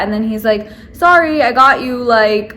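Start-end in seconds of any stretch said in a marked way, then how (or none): 0.9-2.0 higher, louder hurried apology; mock the nail tech's quick sorry; a hint of a flustered nail tech; quoting the nail tech.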